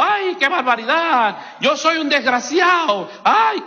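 slow, drawn-out mock wailing; exaggerated wail, rising to a high "ay"; mock crying out. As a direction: lament it like a hammed-up complainer. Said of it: "tú estás gritando diciendo".

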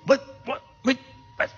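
high muffled little bursts. Acted. Preacher voices wrapped-up Lazarus for the congregation, high muffled voice. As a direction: imitate someone muffled inside wrappings. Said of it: "they heard a muffled noise".

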